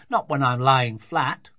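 loud, slow protest; drawn-out desperate objection; desperate father. A narrator's reading. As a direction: plead loudly to be let off. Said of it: "said her father desperately".

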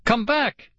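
very high pleading call; narrated scream. A narrator's reading. Kid begs Arthur to return, screaming. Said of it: "I screamed".

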